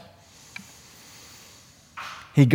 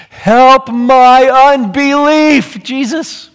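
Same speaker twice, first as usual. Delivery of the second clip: pleading cry; 9 semitones higher, 6 dB louder, much slower than usual. Acted. Father's cry voiced again.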